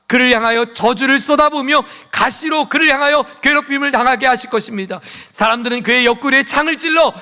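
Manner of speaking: high, loud impassioned sermon; ringing, high-pitched pulpit proclamation; full-force preaching. Real, not acted. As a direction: preach it out to the whole congregation.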